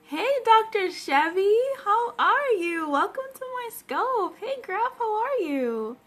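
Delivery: bright, high greeting called out to a viewer; high, eager hello to a newcomer; excited greeting. Real, not acted. Live-stream host greeting a viewer.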